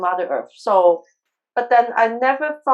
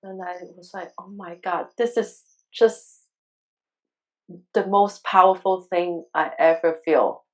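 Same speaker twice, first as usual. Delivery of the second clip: tearful; 5 semitones lower than usual. Real, not acted. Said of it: "tears again and again".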